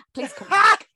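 high, loud plea; mock pleading cry. Real, not acted. Host begging American listeners to return, loud mock plea. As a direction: call out after leaving listeners.

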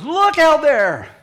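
very loud, high, drawn-out shout; mother's scream. Retold or imitated. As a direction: cry out to everyone in the car. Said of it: "Don't scream".

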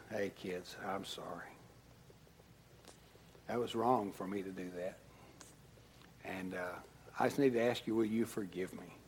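soft, quiet sincere sorry; gentle apology. Retold or imitated. Dad apologizing to his kids in the back. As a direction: humbly ask forgiveness. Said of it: "And I said".

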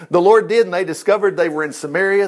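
normal talking voice

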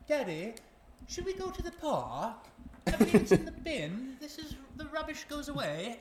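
put-on high childlike voice with a thick Scottish accent; kid's thick Scottish accent. Father imitates his four-year-old son talking to daddy, in a thick Scottish accent. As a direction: mimic a little boy's thick Scottish accent. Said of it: "I forgot how thick that accent was".

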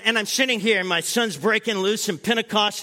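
ordinary talk

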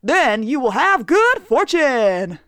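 upbeat commercial pitch; ad read. Acted. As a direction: sell it like a TV ad. Mock advertisement.

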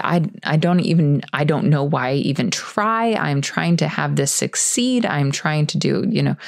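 usual speaking voice

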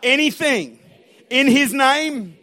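loud, slow declaration in short phrases; emphatic call-and-response preaching; leading a congregational repeat. Real, not acted. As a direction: proclaim it for the crowd to echo. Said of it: "say this after me".

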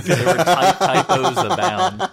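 words breaking into laughter; laughing. Real, not acted. Cohost admitting he edited the typo-filled message, laughing. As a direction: talk through a rising fit of laughter. Cracking up over message typos.

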